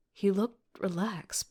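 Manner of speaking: plain reading voice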